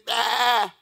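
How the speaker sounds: short bleating bah; mock lamb bleat.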